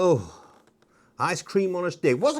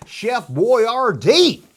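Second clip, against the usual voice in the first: put-on slow, louder ad-announcer drawl of Chef Boyardee; jingle-style announcement; 5 semitones higher, 6 dB louder, much slower than usual. Showing the Chef Boyardee boxcar to viewers, slow mock ad read. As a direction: announce it like an old TV ad. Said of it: "I couldn't resist it".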